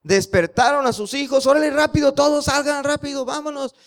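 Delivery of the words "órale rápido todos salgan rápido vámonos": agudo y fuerte, urgente; apurando a gritos. Acted. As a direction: saca a todos de la casa ya. Padres apuran a los hijos. (los papás apuran a los niños a salir, gritando urgidos)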